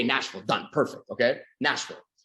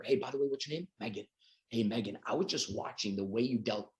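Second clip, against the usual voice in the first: softer, confiding aside; quiet approach; 3 semitones lower, 8 dB quieter than usual. Pretend. Seller quietly approaching Megan, confiding. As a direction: pull her in close and low.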